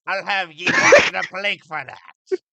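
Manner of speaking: loud high hammy pirate. Pretend. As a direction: growl it out like a cartoon pirate. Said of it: "The Ranting for Vengeance pirate voice".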